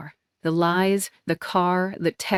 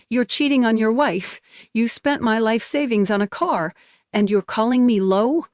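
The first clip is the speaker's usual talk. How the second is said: furious; 4 semitones higher, 3 dB louder than usual. Acted. Furious confrontation.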